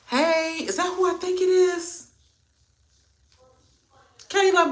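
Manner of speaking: light up at a familiar name; surprised recognition. Real, not acted. Spotting a familiar viewer in chat, pleasantly surprised.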